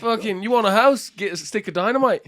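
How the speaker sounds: high-pitched, loud Australian sales patter; mock Australian accent. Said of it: "That's your Australian accent?".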